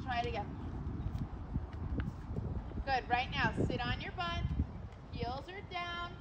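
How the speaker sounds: normal talking voice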